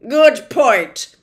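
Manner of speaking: loud, slowish officious policeman; pompous policeman. Acted. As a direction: lay down the law with bluster. Policeman agrees.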